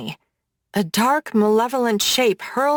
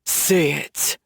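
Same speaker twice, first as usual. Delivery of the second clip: low hissed order; 5 semitones lower than usual. A narrator's reading. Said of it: "Gavin hisses".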